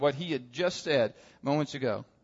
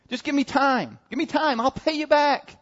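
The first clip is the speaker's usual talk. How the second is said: high-pitched plea for more time; begging; 9 semitones higher than usual. Acted. Debtor begging the king for mercy, high urgent plea. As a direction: plead urgently in a raised pitch. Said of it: "he begs for mercy".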